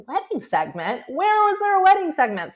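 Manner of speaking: retold surprised question, pitch leaping high; excited disbelief. Reporter retelling her reaction to a source's tip, excited and surprised. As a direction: replay an astonished, eager follow-up question. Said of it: "So I got all excited".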